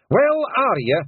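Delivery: high, loud Scots pixie bravado; cocky little brawler squaring up; Rob Anybody. A narrator's reading. Rob Anybody defying the Queen, high and loud Scots.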